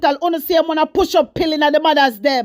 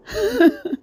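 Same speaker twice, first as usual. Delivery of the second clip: high laugh; 8 semitones higher than usual. Real, not acted. Laughing at minister's comment.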